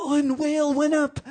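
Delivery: loud, very high strained cry; straining with effort. Acted. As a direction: shout it mid-heave, then let breath go. Narrating mid-push, still straining.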